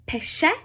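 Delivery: "Pesce" said PEH-sheh with a sh. Shown as a demonstration. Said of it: "S C I or S C E".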